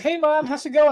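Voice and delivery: put-on high, loud, carefree kid voice; breezy kid. Bleeding boy strolls in and greets his mom, high and breezy. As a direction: chirp it like nothing's wrong.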